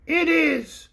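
high, slow toy bear voice; the pink bear answers the king; small shrill voice. Acted.

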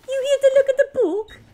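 high, chirpy put-on voice; interrupting book pusher. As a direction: butt in with a high singsong offer. Skit voicing an interrupting character.

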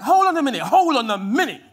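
bang on the door and demand; mob yelling. Retold or imitated. Baying crowd at Lot's door, loud demanding shout. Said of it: "And the crowd was like".

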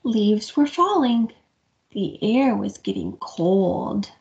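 gentle picture-book reading; reading to children. A narrator's reading. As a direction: read it to little ones. Story narration to children.

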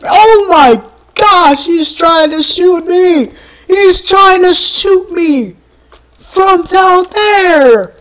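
high, drawn-out panicky complaint; alarmed disbelief. Real, not acted. Player being shot from below, alarmed disbelief. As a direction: cry out in high-pitched alarm.

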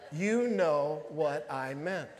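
slow, measured mock exasperation; acted long-suffering reply, word by word; retold exasperation. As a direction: stretch out each word, imitating a fed-up wife.

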